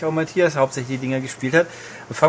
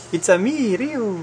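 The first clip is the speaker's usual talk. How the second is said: Mario voice; 7 semitones higher, slower than usual. Pretend. Mario catchphrase parody with Ryu.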